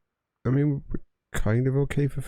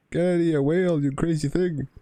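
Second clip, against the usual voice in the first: shouting; 7 semitones higher than usual. Real, not acted. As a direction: shoo it away with a shout. Shooing a whale off shore.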